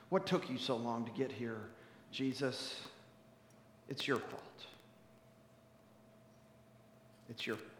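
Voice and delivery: low, very soft accusation; grieving reproach. Acted. Grieving sister to Jesus, hushed reproach. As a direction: blame him quietly, with long pauses.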